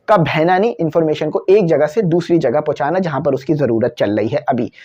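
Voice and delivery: ordinary talk, nothing marked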